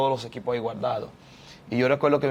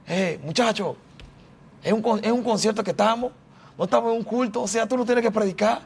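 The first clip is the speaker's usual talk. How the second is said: high-pitched imitation of an exasperated brother; protesting brother; 10 semitones higher than usual. Brother objecting to preaching at a concert, imitated high-pitched protest. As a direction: set him straight, voice pitched way up. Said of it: "mi hermano me decía".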